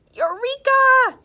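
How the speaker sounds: high, loud triumphant shout. A narrator's reading. Stu struck by his idea, high loud shout. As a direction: burst out with discovery. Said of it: "he shouted".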